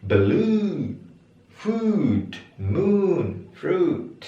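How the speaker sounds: "Blue", "Food", "Moon", "Fruit" each with a long oo pitched high. Shown as a demonstration. Teacher modelling high long U words for the class. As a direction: lift every long oo high. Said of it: "I-nya I tinggi".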